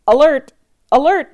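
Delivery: high, loud alarm shout. Acted. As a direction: shout the warning urgently. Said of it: "shouted Sergeant Tibbs".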